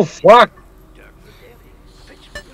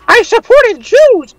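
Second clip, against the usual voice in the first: very high, slow squeaky delivery; high-pitched comic character voice; joking put-on voice. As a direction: draw it out in a silly high voice. Joke line in put-on voice.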